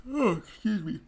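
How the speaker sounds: stifling a yawn while speaking. Real, not acted. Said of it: "Sorry I'm yawning".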